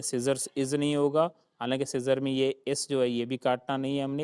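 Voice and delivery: ordinary talk, nothing marked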